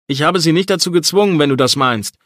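loud, forceful, high retort; insisting he forced no one. A narrator's reading. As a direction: fire it back hard, defending yourself. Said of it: "gab ich energisch zurück".

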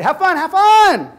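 high, loud chirpy farewell; sing-song goodbye. Retold or imitated. Boyfriend calling after Lucy, high chirpy. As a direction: chirp it out overly sweet.

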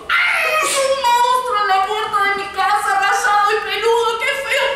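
high, loud shriek of fright; frightened scream. Acted. Chanchita finding a monster at her door, shrieking loudly.

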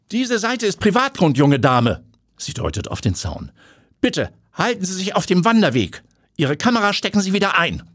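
high, prim, commanding voice; a haughty property owner; stern lady of the house. Acted. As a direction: shoo the intruder off her land. Owner scolds trespasser.

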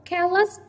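"Careless" with CARE high and loud; first-syllable stress. Shown as a demonstration. Teacher modelling suffix stress, "Careless" punched on CARE. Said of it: "trọng âm rơi vào âm tiết thứ nhất".

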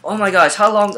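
exasperated customer. Retold or imitated. Impatient customer complaining about the long checkout line, exasperated. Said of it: "one of the customers was just like".

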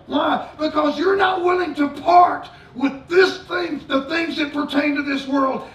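shouting sermon, high and loud; fiery preaching. Acted. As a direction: preach it at full force to the congregation. Preaching at full force.

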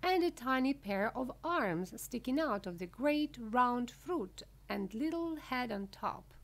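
usual speaking voice

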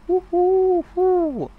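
high falsetto owl hoots. Pretend. Streamer hooting for chat like an owl.

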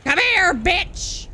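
angry yell. Real, not acted. Gamer going after an enemy, angry yell. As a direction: bellow it at an enemy. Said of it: "I'm yelling and I'm being obnoxious".

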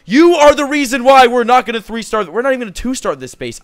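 high, loud frustrated scolding; a gamer scolding his queen; angry rant. Real, not acted. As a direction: scold her for ruining everything. Blaming queen for failed raid.

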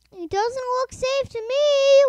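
high, loud worried wail; frightened, high-pitched cry. A narrator's reading. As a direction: wail it out in alarm. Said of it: "wailed Toby".

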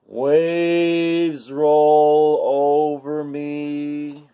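slow, expressive storybook reading; reading to children. A narrator's reading. Storyteller reads to kids, slow and expressive. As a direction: paint each line for little listeners.

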